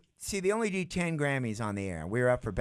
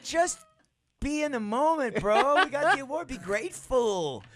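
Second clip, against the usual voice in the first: calming bro; 14 semitones higher than usual. Retold or imitated. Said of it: "I'm like".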